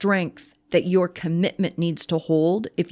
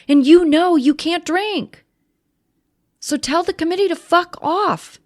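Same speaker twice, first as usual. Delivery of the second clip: raised, forceful pitch, hammering the point home; impassioned sobriety sermon; fired-up preaching; 8 semitones higher than usual. Acted. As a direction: preach it at the listener, high and urgent. Impassioned recovery pep talk.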